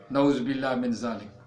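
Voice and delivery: ordinary talk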